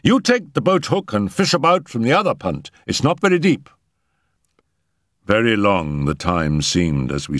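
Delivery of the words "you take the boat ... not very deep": higher, pressing voice; urgent instructions. A narrator's reading. Settle directing the search from the punt, urgent. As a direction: give orders in the thick of a rescue. Urgent orders during pond rescue.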